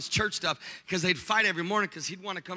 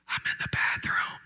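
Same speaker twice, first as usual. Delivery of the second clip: voiceless, hammed-up phone whisper; secretive whisper. Husband hiding in bathroom on phone, secretive whisper.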